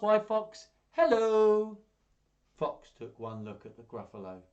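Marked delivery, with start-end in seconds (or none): 0.0-1.8 high, loud sing-out hello; chirpy mouse greeting. Acted. Mouse hailing Fox on the path, high and cheery. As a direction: call out cheerily.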